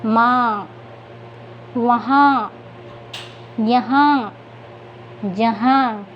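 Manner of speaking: drawn-out model words, each on its own; teacher's slow word list with pauses between words; reading practice. Shown as a demonstration. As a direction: read word by word for young learners, nasal vowels stretched. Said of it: "तो चलिए पढ़ते हैं".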